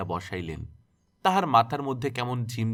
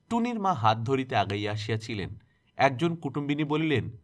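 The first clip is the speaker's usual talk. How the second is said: ordinary delivery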